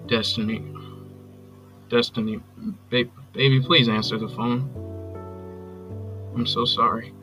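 low, halting apology with long pauses; subdued, contrite voicemail plea; remorseful pleading. Acted. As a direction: beg for another chance, searching for words.

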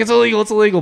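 high, hurried repeated warning; mock alarm. Driver echoing parents' car-light warning, mock-panicked. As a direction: fret it out in a comic fluster. Acting out childhood worry about light.

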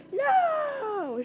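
high, drawn-out acted scream; long, high-pitched storybook wail. A narrator's reading. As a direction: scream it out in character. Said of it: "screamed Airstrike".

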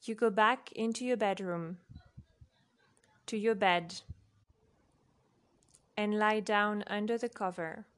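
ordinary delivery, nothing marked

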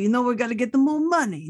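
light New York gangster voice; hint of a New York wiseguy; New York accent. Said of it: "Now I'm just going New York".

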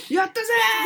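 hammed-up elated cheer; mock cheer. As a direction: celebrate a tiny win with playful triumph. Acting out delight at meeting goal.

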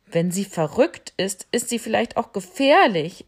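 lion cub alarming the others; worried lion cub. Acted. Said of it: "sagte das Löwenjunge". (besorgtes Löwenjunges, lauter)

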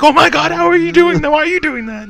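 very high, loud panicked squeal; freaking out. Retold or imitated. Retelling Vegeta's reaction to casual talk with Beerus, freaking out. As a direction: shriek in hammed-up panic. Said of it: "Freaking out".

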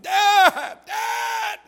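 a high, loud, far-carrying scream of Dad; desperate cry. Retold or imitated. Drowning boy crying out to his dad from the lake, screaming. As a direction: call out for your father as you go under. Drowning son calls out for help.

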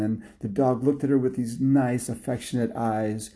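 ordinary delivery

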